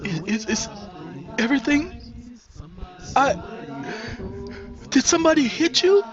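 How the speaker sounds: high stammering alarm; high anxious questions. Acted. Nisi asks crying Mary, high and alarmed. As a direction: ask in rising panic.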